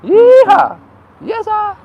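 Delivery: real cowboy shout; loud whoop. Real, not acted. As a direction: whoop it out to the street. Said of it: "I'm gonna shout this place out".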